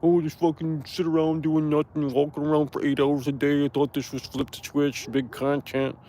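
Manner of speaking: parodying chat's complaints; mocking whiny viewer. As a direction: whine like a spoiled chatter. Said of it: "People wrote novels about that shit".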